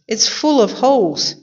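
high-pitched storybook dismay; exclaiming. A narrator's reading. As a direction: cry it out in mild alarm. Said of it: "exclaimed Missus Rogers".

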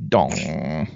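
low, ominous piano "dong"; imitated sinister piano. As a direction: mimic a deep, foreboding piano note. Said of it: "Sinister piano".

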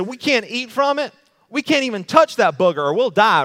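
high, loud mock whine of Eve's misquote. Preacher mimics Eve misquoting God, high mocking whine. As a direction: parody her, high and overblown. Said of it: "Do you remember what Eve said?".

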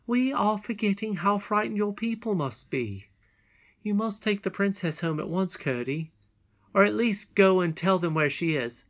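a touch of firm urging; concerned mother. A narrator's reading. Mother telling Curdie to take the princess home, concerned.

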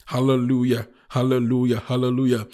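loud, level chant-like hallelujahs; praise exclamation. Real, not acted. Preacher's repeated praise cry.

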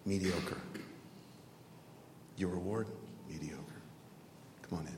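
very low, soft, long pauses; hushed verdict. Acted. God judging a man's reward, hushed and low. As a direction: pronounce it quietly and let it hang.

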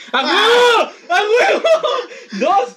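high, loud victory yells; excited. Real, not acted. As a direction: whoop and holler in triumph. Celebrating surviving the draw.

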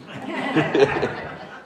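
a short, embarrassed burst of laughter. Real, not acted. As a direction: laugh at your own slip. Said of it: "Sorry, I didn't mean to say that out loud".

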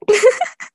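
loud, high cartoon guffaw; high hiccupping mock laugh; Goofy. Shown as a demonstration. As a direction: imitate a goofy cartoon chuckle. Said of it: "I always impersonated Goofy's laugh".

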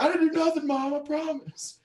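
put-on high pleading teenage protest; whiny kid. Accused kid protesting to his mom, high whiny denial. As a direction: squeal your innocence to Mom. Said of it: "he's like".

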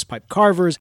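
usual speaking voice

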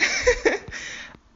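high-pitched burst of laughter. Real, not acted. Amused at Laban giving in, high laugh.

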